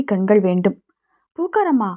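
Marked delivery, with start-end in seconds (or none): 1.4-1.9 high bright call of her name; cheerful call. Acted. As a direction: call out பூக்காரம்மா happily, pitched up. Groom calls out greeting her. (பூக்காரம்மாவை உற்சாகமாக உயர்ந்த குரலில் அழைத்தல்)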